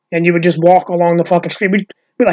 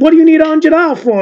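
put-on high, indignant Italian American accent; Uncle Louie; 10 semitones higher, 4 dB louder than usual. Imitated dad questioning his son, Italian American accent. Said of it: "And I said".

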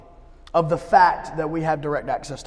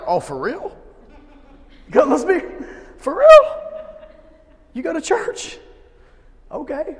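much higher and louder, mock disbelief; incredulous coworker; 11 semitones higher, 10 dB louder than usual. Coworker learning we go to church, mock disbelief. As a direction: squeak out a hammed-up double take.

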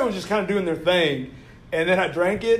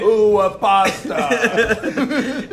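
drawn-out, louder mock Italian accent; bad Italian accent; 4 semitones higher, 6 dB louder, much slower than usual. Friend teasing the impressionist, in bad Italian accent. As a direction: imitate a feeble Italian accent, higher and slow. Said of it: "anytime Tyler does an accent".

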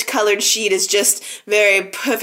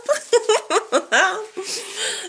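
high giggle; 9 semitones higher, 5 dB quieter than usual. Real, not acted. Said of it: "laughing at snuggling".

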